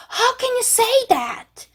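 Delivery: very high, stretched-out hurt protest; 감정이 상한 듯. Shown as a demonstration. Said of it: "여러분 막 감정적으로 상했을 때". (상처받아 아주 높게 늘여 따지기)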